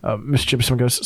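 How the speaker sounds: usual speaking voice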